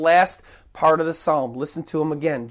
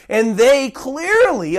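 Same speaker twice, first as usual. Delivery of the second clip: normal talking voice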